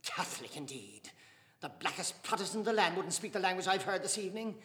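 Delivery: loud, high scorn; scornful Dante. Acted. Dante scorning Mr Casey's Catholicism, loud. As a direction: sneer at the claim.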